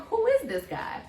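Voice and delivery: retold alarm, pitched high; mildly startled, high-pitched question; a little scared. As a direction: imitate your own bewildered, slightly scared reaction. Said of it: "I was a little scared".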